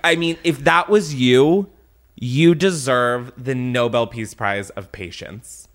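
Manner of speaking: normal talking voice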